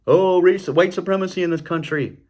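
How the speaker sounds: high, loud sneering parody of a news pundit; mock media outrage. Mocking the media, a high loud parody voice.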